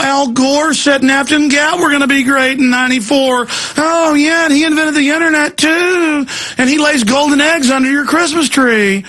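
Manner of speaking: mock the official line with biting irony; sarcastic mockery. Real, not acted. Mocking globalism's boosters, high and sarcastic.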